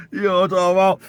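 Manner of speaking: high and loud while still laughing; laughing through it. Real, not acted. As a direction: talk through the tail of the laugh.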